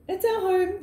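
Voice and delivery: overjoyed at the home; delighted gasp. Real, not acted. Joy at new home.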